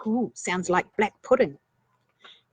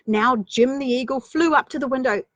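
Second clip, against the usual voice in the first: plain reading voice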